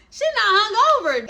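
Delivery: high, mocking imitation of a friend. Mocking Darnisha.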